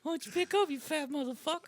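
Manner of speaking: high, soft geezer voice; cartoon old man cursing. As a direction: give up and cuss in a tiny high old-man voice. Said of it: "gives up and he starts cursing him out".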